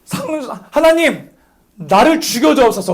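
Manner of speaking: high-pitched mock plea to God; acted prayer. Shown as a demonstration. Preacher praying to Sangjun playing God, mock plea. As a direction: play the desperate pray-er. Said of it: "내가 너한테 기도하는 거야".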